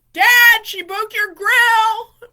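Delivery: loud, high imitation of a tattletale; retold holler, drawn out at the end; tattling shout. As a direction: yell to Dad, stretching the last word. Stepbrother tattles to dad in story.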